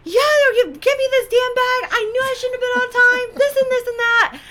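loud, high-pitched retold tirade; cursing someone out. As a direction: rant shrilly as if berating an airline clerk. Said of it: "I'm cursing this lady up and down".

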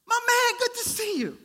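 high, loud breezy greeting; friendly coworker. Acted. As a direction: greet him like an old buddy. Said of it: "give the Queen's version of it".

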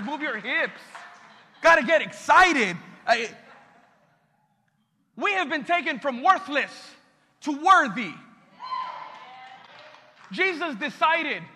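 loud, rising pastor building the crowd; fiery preaching. Acted. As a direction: preach it out, push the energy up. Preaching at full force.

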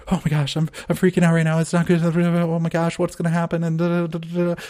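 high, loud, rushed panic; panicked anxious voice. Shown as a demonstration. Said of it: "speaking like that and breathing like that".

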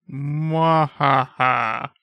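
slow, hammed-up evil laugh. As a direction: draw out a mock villain cackle. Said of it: "less evil laughing".

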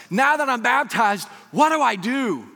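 high, loud pleading question; hammed-up bewilderment; mock panic. Newly baptized lady asks what comes next, mock panic. Acting out the lady's worried question.

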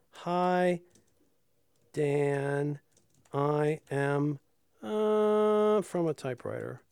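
flat monotone robot voice. Shown as a demonstration. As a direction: speak like a deadpan robot. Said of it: "whenever I'm doing a robot voice".

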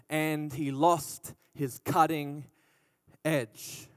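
drawn out slowly; slow and heavy. Real, not acted. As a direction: let each word sink, slowly. Slowed for emphasis.

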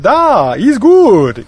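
mock Russian accent, pitched high and drawn out; mock Colossus. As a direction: exaggerate a Russian accent for a comic impression. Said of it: "Colossus is really like".

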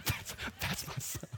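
breathy proud whisper to the crowd. Retold or imitated. Mom whispering proudly to people in the gym, hushed.

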